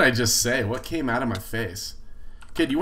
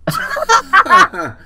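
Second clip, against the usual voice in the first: laughing; 14 dB louder than usual. Real, not acted. Burst of laughter.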